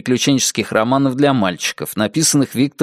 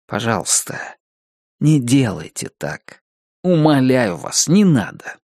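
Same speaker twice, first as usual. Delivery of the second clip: earnest pleading. Real, not acted. Narrator begging writers to stop doing this, earnest pleading. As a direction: beg gently, pausing between phrases. Author begs readers to stop.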